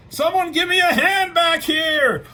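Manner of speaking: loud, very high mock-desperate shout; struggling cry for help. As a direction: yell for backup in the thick of a fight. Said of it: "he's struggling as he would".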